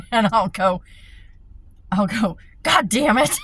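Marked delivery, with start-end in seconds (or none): 2.6-3.4 loud, higher exasperated curse; annoyed curse. Retold or imitated. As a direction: snap it at the phone. Retold cursing at phone.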